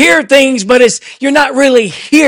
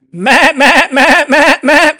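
imitated sheep bleats; slower than usual. Preacher mimics a flock of sheep for the congregation, bleating. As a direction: run of quick sheep baas.

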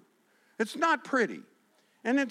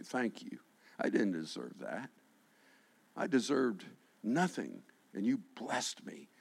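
humble thanks; 10 semitones lower, 9 dB quieter than usual. Retold or imitated. Peter voiced thanking Jesus humbly.

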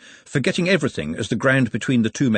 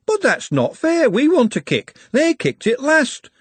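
put-on higher, childish complaint; childish whine; 12 semitones higher, 3 dB louder than usual. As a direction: whine like a sulking child about fairness. Hoggett whining about fairness.

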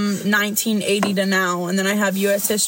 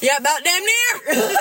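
put-on Southern accent; 6 semitones higher than usual. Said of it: "a good Southern accent".

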